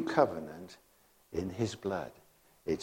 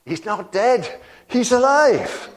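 much higher and louder, bursting with a laugh; joyful proclamation; 11 semitones higher, 7 dB louder than usual. Real, not acted. Preacher proclaiming to the church that Jesus lives, high laughing shout. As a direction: shout the news like it can't be held in.

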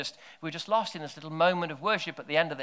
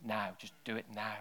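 soft voice of God; 4 semitones lower, 13 dB quieter than usual. Pretend. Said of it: "hear God going".

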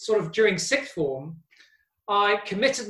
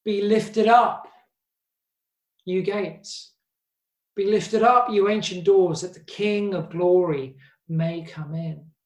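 proclaiming scripture. Acted. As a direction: lift the verse up like a psalm. A prayer and a song.

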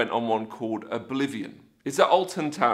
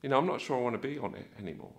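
quick, soft inner voice; hushed, hurried private doubt; thinking to oneself; 2 semitones lower, 8 dB quieter, much faster than usual. Acted. Rider doubting once locked in, thinking to oneself. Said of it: "start to think to yourself".

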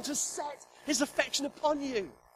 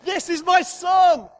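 slow proud shout, higher and louder; a father bellowing his pride; 5 semitones higher, 10 dB louder, slower than usual. Shown as a demonstration. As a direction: shout it out to the whole world. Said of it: "He wants to show you off to the world".

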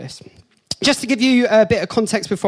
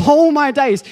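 shocked gasp; 6 semitones higher, 5 dB louder than usual. Retold or imitated. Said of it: "And I was like".

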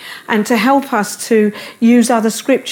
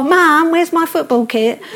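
put-on imagined child; 6 semitones higher than usual.

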